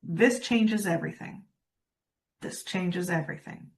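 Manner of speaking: flat, lifeless, no energy at all; super flat. Shown as a demonstration. Coach demonstrating to audience, deadpan and flat. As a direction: drain every bit of life from it. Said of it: "Super flat".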